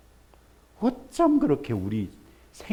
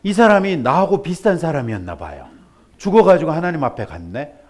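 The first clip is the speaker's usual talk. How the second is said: usual speaking voice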